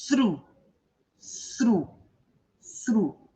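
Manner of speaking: "Through" opening on a blown th, long u; teacher repeating an isolated, careful "Through"; pronunciation demo. Shown as a demonstration. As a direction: model "Through" alone, th then r and falling u. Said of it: "As duas são longas".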